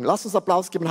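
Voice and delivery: ordinary talk, nothing marked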